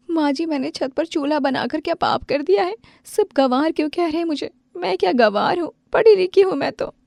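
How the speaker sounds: put-on young wife protesting she is called a villager; hurt and upset. Hurt wife complains, rising.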